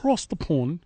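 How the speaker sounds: a hint of a British accent on Across the pond; mock British. Shown as a demonstration. Host echoes the British phrase in a light mock British accent. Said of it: "That's how they say it".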